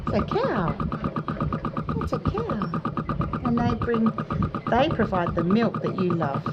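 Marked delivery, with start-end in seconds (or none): 0.1-4.0 higher sing-song reassurance to a pet. Real, not acted. Owner reassuring a scared calf about cows, high cooing.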